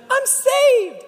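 put-on high squeaky voice of a relieved kid; mock kid relief. Kid at church sure he's saved, high and squeaky. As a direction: chirp it like a child sure he's fine.

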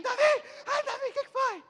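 high, fussing overprotective mother. Retold or imitated. Preacher playing the overprotective mother fussing over her son, high and anxious. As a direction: coo over the boy in a high panic. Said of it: "Aí chegou no menino".

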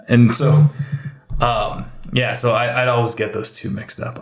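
ordinary talk, nothing marked

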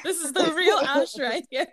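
laughing. Real, not acted. Introducing her friend to listeners, laughing. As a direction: present her friend through giggles.

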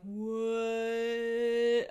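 long, loud, drawn-out slow-motion what; slow-motion shock. Retold or imitated. Contact falls toward the sink, slow-motion shock. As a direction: stretch the word out as if time has slowed. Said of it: "slow motion happening in my brain".

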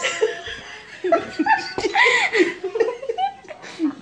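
high giggling fit; nervous laughter. Real, not acted. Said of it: "Nervous laughter".

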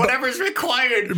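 high, slow mock-salesman voice; hammed-up huckster promise. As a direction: pitch it like a smarmy seller, up high. Mocking the salesman's pitch.

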